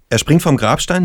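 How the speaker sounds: ordinary delivery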